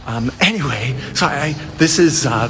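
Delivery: ordinary delivery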